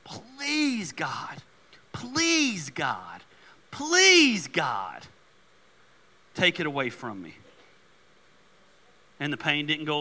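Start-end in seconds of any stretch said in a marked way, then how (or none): 0.0-5.1 pleading. Acted. Preacher voicing Paul begging God three times, pleading. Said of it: "he cried out, he begged, he pleaded". 6.3-7.4 low, subdued plea; pleading. Acted. Preacher voicing Paul asking God for relief, subdued pleading. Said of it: "he pleaded with God".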